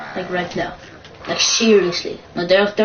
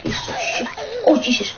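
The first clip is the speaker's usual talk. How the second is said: laughing; 11 semitones higher than usual. Real, not acted. Laughing after the fight.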